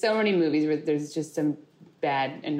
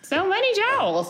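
high and slow; 14 semitones higher than usual. Real, not acted. Exaggerated jowls remark.

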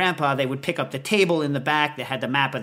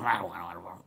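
much lower, much softer sulky grumble; grandpa's mumble; 10 semitones lower, 11 dB quieter, much faster than usual. Retold or imitated. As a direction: mutter it to yourself reluctantly. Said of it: "Grandpa would mumble".

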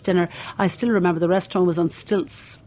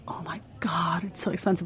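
whispered inner thought; 8 dB quieter than usual. Retold or imitated. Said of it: "And I thought".

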